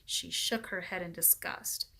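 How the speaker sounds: usual speaking voice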